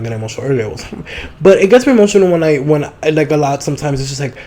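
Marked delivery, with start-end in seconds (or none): none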